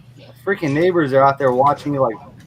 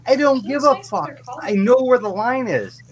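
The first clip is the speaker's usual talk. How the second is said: defiant retort; 7 semitones higher than usual. Retold or imitated. Retort to pointing neighbor.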